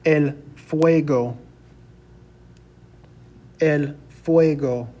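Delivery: fuego as FWAY-go, slowly; slow el fuego, a gap between the words; Spanish pronunciation. Shown as a demonstration. Teacher tells students how to say fire, slow demonstration. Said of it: "we're gonna say".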